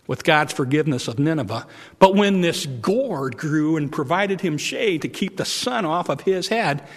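ordinary delivery

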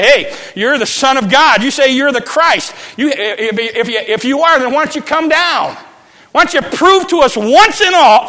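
high, loud jeering yell at the cross; mock him with everything you have; shouting thief taunting Jesus. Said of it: "one of them cried out".

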